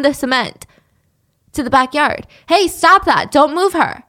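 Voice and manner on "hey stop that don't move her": loud, high command to stop; sharp yell. Retold or imitated. Neighbor yells at Tony.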